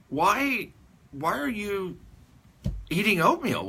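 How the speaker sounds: high-pitched, halting puzzled question. Retold or imitated. Father asks Curly about his odd saloon meal, puzzled. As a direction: imitate someone baffled by what they see.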